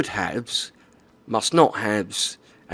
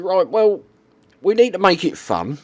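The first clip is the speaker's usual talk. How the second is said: high, louder quoted developer; 9 semitones higher, 5 dB louder than usual. Retold or imitated. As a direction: pitch it up as the devs. Voicing Wargaming staff.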